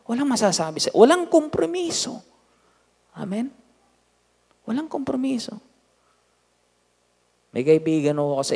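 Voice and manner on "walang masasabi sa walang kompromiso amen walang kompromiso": preaching. Acted. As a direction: drive the point home to the church. Pastor preaching to congregation. (masigasig na pangangaral nang malakas)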